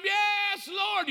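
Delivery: high, loud cry of praise; the congregation in full praise; shouted praise. Retold or imitated. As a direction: shout it with the crowd. Whole room shouting praise.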